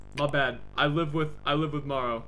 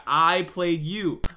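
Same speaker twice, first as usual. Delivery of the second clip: slow gloating; 4 semitones higher, 7 dB louder, much slower than usual. Real, not acted. Gloating at the game.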